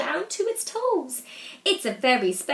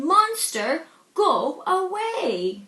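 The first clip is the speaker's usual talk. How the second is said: louder, slow and stern Monster go away; sign read sternly; 4 semitones higher, 5 dB louder, slower than usual. Acted. Reading the deputy head's sign to the monster, stern and loud. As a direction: spell out the sign like a cross order.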